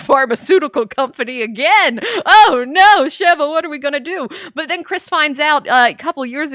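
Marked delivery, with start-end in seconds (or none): 2.3-4.3 high, loud hammed-up panicked cry; mock panic. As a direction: play Chris freaking out, half joking. Chris voiced panicking in retelling.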